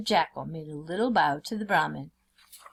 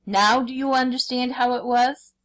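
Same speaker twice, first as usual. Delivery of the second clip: higher, louder bellow from inside the cage; bellowing tiger; 6 semitones higher, 6 dB louder than usual. A narrator's reading. Tiger bellows at the jackal from the cage, impatient bellow.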